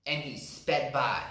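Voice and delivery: ordinary delivery, nothing marked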